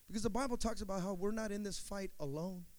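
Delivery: ordinary talk, nothing marked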